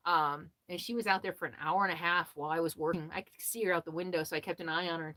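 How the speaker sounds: ordinary talk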